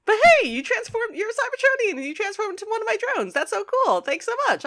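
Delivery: very high, gushing mock delight; excited inventor. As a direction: gush in a high, hurried rush. Voicing Wheeljack's delight.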